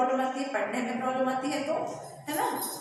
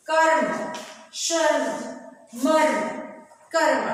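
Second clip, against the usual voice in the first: each word called out louder; loud model reading; 2 semitones higher, 3 dB louder than usual. Shown as a demonstration. Teacher models word list. (कक्षा के लिए ज़ोर से शब्द पढ़ना)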